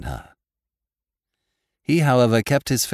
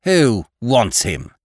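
loud, slow, higher-pitched challenge; rough cry; 5 semitones higher, 8 dB louder, slower than usual. A narrator's reading. Laura to the other girls, crying out roughly. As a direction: throw the question out roughly. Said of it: "cried Laura roughly".

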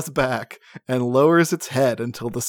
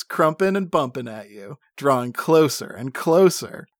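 ordinary delivery

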